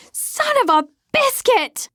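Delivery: high, stretched pained yell; shout at the top of lungs. Acted. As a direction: howl it out as the phone hits her foot. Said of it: "I shout at the top of my lungs".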